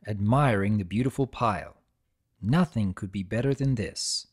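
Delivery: ordinary delivery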